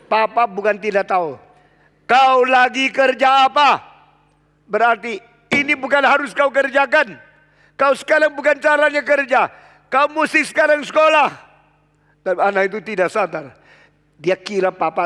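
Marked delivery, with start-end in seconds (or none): 0.1-11.3 loud, high lecturing; scolding father. Retold or imitated. A father lectures his son to go to school, loud and firm. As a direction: lay down the law to the kid. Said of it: "father scolds child".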